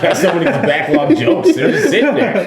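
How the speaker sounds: laughing. Real, not acted. Laughing through a joke.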